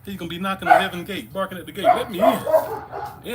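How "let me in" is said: dog begging voice. Pretend. Dog barking at heaven's gate.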